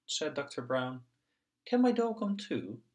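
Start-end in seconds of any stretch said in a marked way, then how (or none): 1.7-2.8 put-on high child voice. Little pig child asks doctor.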